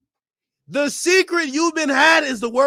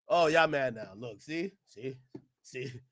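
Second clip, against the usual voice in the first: softer, lower teasing; 11 semitones lower, 12 dB quieter than usual. Acted. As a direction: taunt them under your breath. Teasing trailing off.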